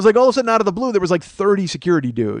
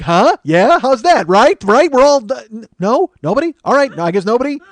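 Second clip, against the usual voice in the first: acted fishing for agreement; 5 semitones higher, 5 dB louder than usual. Seeks agreement, gets none.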